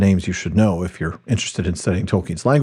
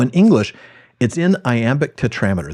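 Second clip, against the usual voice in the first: ordinary talk, nothing marked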